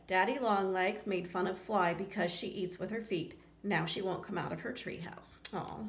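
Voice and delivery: usual speaking voice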